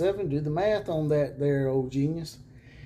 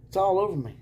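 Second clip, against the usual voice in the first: sarcastic, higher and louder; 7 semitones higher, 6 dB louder, faster than usual. Pretend. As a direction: ham it up, dripping sarcasm. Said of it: "Sarcasm everywhere".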